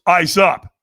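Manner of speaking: loud, abrupt imitated order; trainer's command. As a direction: call it out like a drill coach. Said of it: "the trainer goes".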